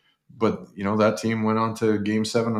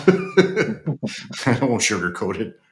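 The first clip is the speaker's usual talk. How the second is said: slow, higher words through a laugh; 4 semitones higher, much slower than usual. Real, not acted. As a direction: let real laughter carry the blunt admission. Laughs after blunt verdict.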